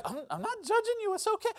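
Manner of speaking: high-pitched, placating voice; mock reassurance. Preacher acting out reassuring someone who feels judged, mock reassurance. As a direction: go way up high to soothe them.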